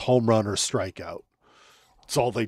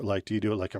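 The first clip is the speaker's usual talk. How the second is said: normal talking voice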